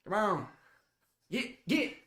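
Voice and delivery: put-on country Southern accent, pitch climbing; put-on Southern farm-folk urging. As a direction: shoo them along in a Southern accent. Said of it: "do a Southern accent".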